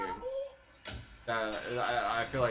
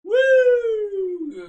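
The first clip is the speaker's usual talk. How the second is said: hammed-up cheering; 12 dB louder, much slower than usual. Cheering a video ending loudly.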